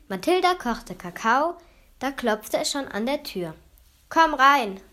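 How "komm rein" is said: loud, raised call; brisk, louder invitation. A narrator's reading. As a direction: call to someone at the door. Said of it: "rief Julebuck".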